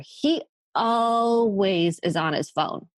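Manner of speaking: long, exasperated stretch on "always"; mock grievance, dragging out "always"; complaining spouse. Acts complaining spouse in therapy.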